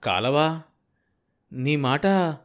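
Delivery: halting question with a long pause; words stick in the throat, unfinished; stunned, lost for words. A narrator's reading. Sambayya hearing of the canal, too stunned to finish. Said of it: "గొంతు నుంచి మిగతా మాటలు పెగిలి రాలేదు".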